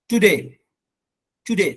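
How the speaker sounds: "टुडे" with a brief "tu"; quick short first syllable. Shown as a demonstration. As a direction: hurry through the "tu" of "टुडे". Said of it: "जल्दी में बोलेंगे इसको". ("टुडे" जल्दी में, छोटा "टु")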